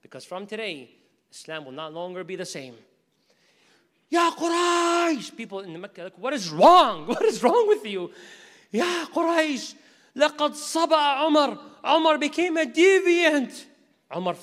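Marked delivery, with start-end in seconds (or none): 4.1-5.4 long, drawn-out high shout; shout at the top of his voice. Acted. Jamil calling out again. 6.2-8.1 loud retort. Acted. Townspeople react. 8.7-13.6 loud proclamation. Acted. Jamil announces through the city.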